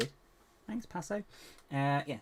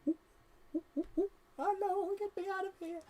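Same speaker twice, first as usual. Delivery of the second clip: squeaky trapped figure. Pretend.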